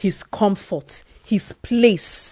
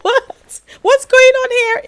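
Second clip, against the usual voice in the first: high-pitched bewildered cry of surprise; shocked and choked up, much higher; teary disbelief; 7 dB louder than usual. Real, not acted. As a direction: squeak it out, caught off guard by tears. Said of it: "I was bawling".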